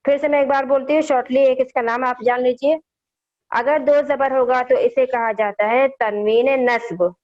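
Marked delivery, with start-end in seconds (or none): none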